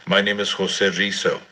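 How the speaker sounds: ordinary talk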